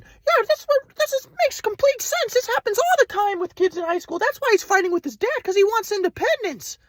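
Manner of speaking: high, airy-calm imitated grandpa; spiritual grandpa. Grandpa from Kauai lecturing Ryan, high serene mock voice. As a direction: preach oneness like a serene guru.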